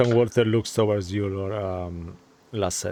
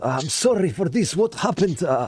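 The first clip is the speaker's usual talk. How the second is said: put-on wounded soldier, half Russian accent, raised pitch; breathless report in a light Russian accent; hint of a Russian accent; 6 semitones higher than usual. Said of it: "There's the accent".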